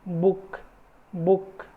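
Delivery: बुक with a short clipped oo; short oo demo. Shown as a demonstration. Teacher models बुक for the class, short oo. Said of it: "ऊ sound".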